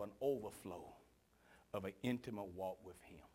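soft whisper; hushed close. Real, not acted. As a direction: whisper as heads bow for prayer. Closing invitation, heads bowed.

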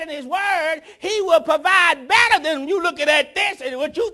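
high, loud, shouted preaching; preacher urging the young people to wait on God, shouting; full-force preaching. Acted. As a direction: bring the church to its feet.

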